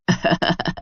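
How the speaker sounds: short soft chuckle; brief acted laugh under the breath. Mother-in-law amused by the request, soft laugh. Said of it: "आप हंस क्यों रही हैं".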